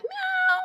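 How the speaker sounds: very high squeaky meow; cat meow. Pretend. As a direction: mew like a tiny kitten. Cat sound while quizzing child.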